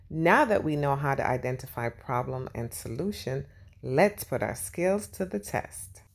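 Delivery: usual speaking voice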